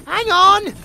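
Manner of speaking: loud, high shout to wait; calling out. Acted. Bard not ready to run, calling out loudly. Calling after departing runners.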